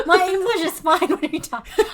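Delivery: laughing while defending herself. Real, not acted. Laughing, defending her English.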